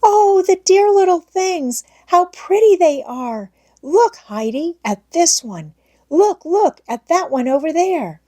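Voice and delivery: put-on high, delighted girlish exclaiming; excited exclaiming. A narrator's reading. Clara exclaiming with delight over the kittens. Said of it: "Clara kept on exclaiming".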